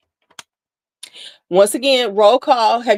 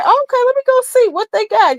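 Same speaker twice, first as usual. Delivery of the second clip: high, bright, pitched-up eagerness; imitating her own squeal of interest, pitched way up; mock eager; 4 dB louder than usual. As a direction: sound keen to hunt for a deal. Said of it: "I was like".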